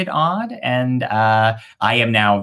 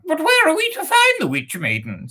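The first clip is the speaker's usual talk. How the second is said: very high squeaky bird question; first bird asking; faster than usual. Acted. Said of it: "said the first bird".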